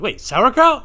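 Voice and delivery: high, loud incredulous query; baffled question. Retold or imitated. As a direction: ask it with a startled rise. Acted surprised reply to accented man.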